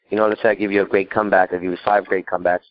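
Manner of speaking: ordinary talk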